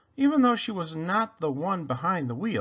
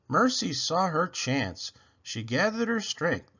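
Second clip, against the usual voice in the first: ordinary delivery, nothing marked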